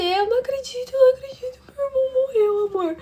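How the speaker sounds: choro agudo e forte, revivendo o desespero; lamente-se aos prantos, com a voz lá em cima; lamento desesperado. Retold or imitated. Conta em prantos ao namorado. (desabafo chorado ao namorado)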